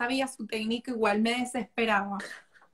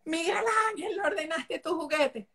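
higher, mock-stern mom calling her son; mother scolding; 5 semitones higher than usual. Son imitating his mother asking about his toy. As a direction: imitate herself nagging the kid about toys. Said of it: "muestra a mí que".

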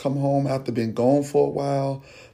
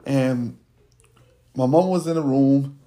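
ordinary talk, nothing marked

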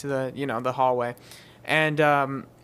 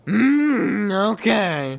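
grudging king; 6 semitones higher, 6 dB louder, much slower than usual. Acted.

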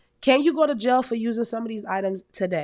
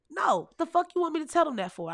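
inner cussing back; 8 semitones higher, faster than usual. Retold or imitated. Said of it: "I didn't say that, but in my mind I did".

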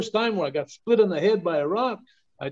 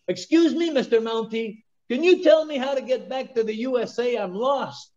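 squeaky, much higher, polite calling out; high-pitched tourist voice; 8 semitones higher than usual. Retold or imitated. Tourist in his car calls up to the Mountie, high and squeaky. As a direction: pipe up high like a nervous tourist. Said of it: "and he goes".

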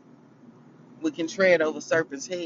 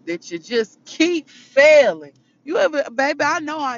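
preaching build; 10 semitones higher, 9 dB louder than usual. Real, not acted. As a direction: lean into failing like a preacher. Preaching emphasis on failing.

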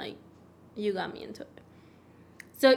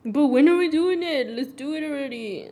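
put-on higher, louder pestering child; whiny kid voice; 3 semitones higher, 6 dB louder than usual. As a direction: nag like an impatient kid. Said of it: "I'm like".

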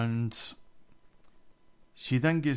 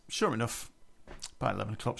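ordinary delivery

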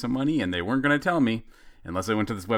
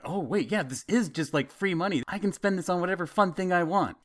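sudden excitement; 9 semitones higher than usual. Retold or imitated. Realizing it's free money.

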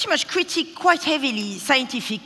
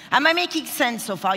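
ordinary delivery

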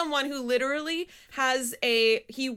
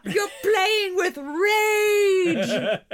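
hammed-up yell, much higher, slow and drawn out; mock furious shout; 10 semitones higher, 7 dB louder, much slower than usual. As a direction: bellow the line like an outraged teacher. Acted teacher yelling at pianist.

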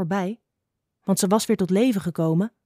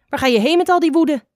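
high, loud called-out question; 8 semitones higher, 7 dB louder than usual. A narrator's reading. Snake asks the angry little adder, calling loudly. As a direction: call it across at them, pitched up. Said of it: "riep ze met een luide stem".

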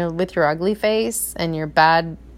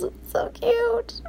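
high, slow cooing over something cute; soft high coo of delight; gushing; 5 dB quieter, much slower than usual. Real, not acted. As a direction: coo it like you melt. Gushing over her son.

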